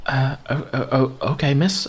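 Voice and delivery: put-on high, flustered boy voice; stammer, overwhelmed and awed; timid young Nairn. Young Nairn greeting the miss, flustered.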